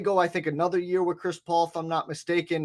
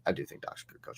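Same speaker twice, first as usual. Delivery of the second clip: whisper; 14 dB quieter, faster than usual. Real, not acted. Repeating the claim, whispered.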